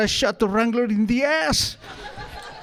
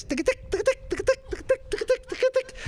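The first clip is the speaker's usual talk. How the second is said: high, soft clicking tick tick sounds; a neat, tiny high-pitched ticking; proper riding rhythm; 5 dB quieter than usual. Shown as a demonstration. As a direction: tap out the horse rhythm lightly and high. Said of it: "If you do it properly, it's like this".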